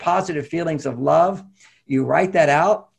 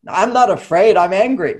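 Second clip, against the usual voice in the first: indignant denial; 6 semitones higher, 6 dB louder than usual. Retold or imitated. Acted partner denies fear.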